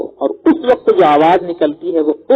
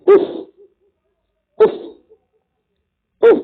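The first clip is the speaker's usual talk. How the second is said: mock annoyed tut. Shown as a demonstration. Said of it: "ناپسندیدگی کے وقت جو آواز نکلتی ہے".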